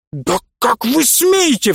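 high, slow outraged flare-up; righteous indignation. A narrator's reading. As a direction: drag it out, wounded and furious. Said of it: "вспылил Прыгунов в праведном негодовании".

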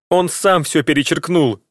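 high, cracking protest; voice breaking. A narrator's reading. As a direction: let the voice crack on перечеркнул. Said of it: "возразила дочь срывающимся голосом".

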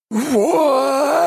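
rapturous high spirit shriek; eldritch horror. Acted. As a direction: howl it with glee. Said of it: "упоенно продолжает домовой".

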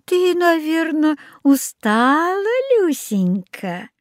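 put-on slow, sugary old woman, starting high; sweet coaxing. A narrator's reading. Old woman fusses over Люсенька, sweet coaxing. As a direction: fuss over a child, drawing words out.

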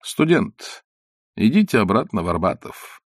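curt and cool, sending him off; dry dismissal. A narrator's reading. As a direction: dismiss him coldly, no warmth. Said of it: "сухо сказал Остап".

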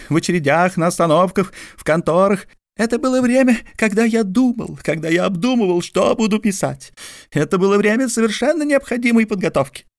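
high, fast exasperated explanation; Mordecai exasperated. A narrator's reading. Mordecai explains to George, high and fast. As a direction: rush it out, pitched high.

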